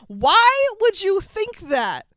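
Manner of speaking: high, drawn-out cry of disbelief; retold mock scream. Viewer yells at the movie, mild mock scream. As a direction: stretch it high, a scream held in check. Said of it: "while watching this movie, screamed".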